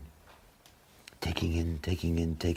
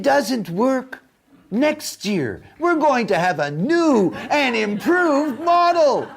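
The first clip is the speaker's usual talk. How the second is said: mock diet salesman; 11 semitones higher than usual. Mocking diet sellers to the audience in a hammed-up pitch. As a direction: sell it like a cheesy TV ad.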